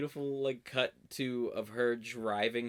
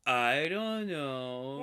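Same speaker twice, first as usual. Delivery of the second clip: mock lost drunk; 3 semitones higher, much slower than usual. Lost boyfriend on the phone, slow and dazed. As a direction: drag it out, lost and confused. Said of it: "And he's like".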